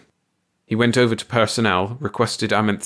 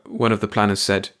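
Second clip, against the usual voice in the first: ordinary delivery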